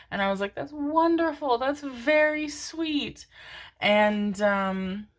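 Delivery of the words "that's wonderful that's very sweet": sweet reply. Retold or imitated. Quoting her sweet reply.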